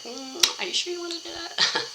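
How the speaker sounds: high, sly, doubting little voice; doubting ego voice. Retold or imitated. The ego questions her new habit, in a high, sly, doubting voice. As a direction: tempt her to back out, sweetly. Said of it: "your ego's gonna be like".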